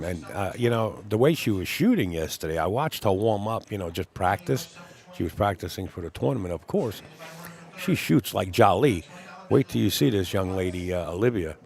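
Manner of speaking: ordinary delivery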